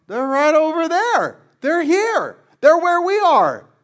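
high, loud, insistent pleading; urgent excited shout. Retold or imitated. Disciples urging Jesus, crowds are here, excited loud shout. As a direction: frantically point out the crowds nearby.

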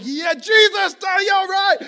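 very high, very loud hammed-up yell; overexcited shout. Dad whose prayer for fish is answered, overexcited shout. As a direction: holler in overjoyed disbelief. Acting dad's overjoyed reaction.

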